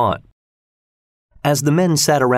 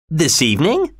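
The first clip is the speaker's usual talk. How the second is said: higher, rising in disbelief; startled higher query; surprised question; 7 semitones higher than usual. Acted. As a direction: ask it as if he can't believe it. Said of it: "asked Mister Stewart".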